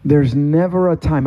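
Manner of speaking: usual speaking voice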